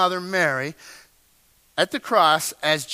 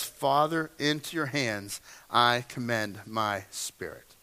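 softer, lower, reverent murmur; quiet trusting prayer; 7 semitones lower, 5 dB quieter than usual. Retold or imitated. Jesus praying softly to the Father, quiet and trusting. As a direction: hand it over gently in trust. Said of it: "displaying his reliance on God".

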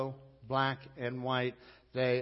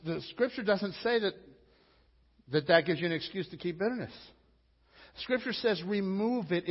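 loud, high preaching at full force; preacher thundering against holding on to bitterness; fiery preaching; 6 semitones higher, 6 dB louder than usual. Acted. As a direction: drive the point home to the congregation.

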